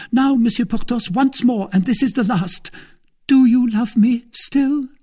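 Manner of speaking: very high, loud female character voice; pleading wife. Acted. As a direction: make one last earnest appeal. Wife's last appeal.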